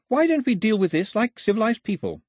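put-on high-pitched, proper girl's voice; high, insistent civility; Daphne, composed. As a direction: reason politely with armed men. Voices Daphne bargaining with gunmen.

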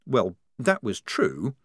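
plain reading voice

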